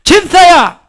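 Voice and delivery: very loud, high shout; full-volume call to the deacon. Shown as a demonstration. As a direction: bellow it even bigger. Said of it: "더 크게".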